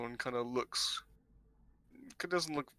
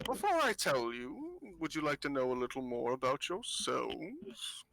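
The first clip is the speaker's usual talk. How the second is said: put-on knowing old fortune-teller; 2 semitones higher than usual. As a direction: dangle a secret before them.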